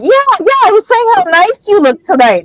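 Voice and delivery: very high, over-bright cover-up; forced cheerful flattery. Retold or imitated. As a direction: gush nervously to smooth it over. Hermione covers up with fake flattery.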